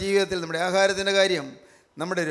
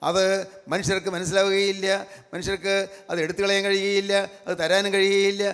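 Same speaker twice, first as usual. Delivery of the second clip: ordinary talk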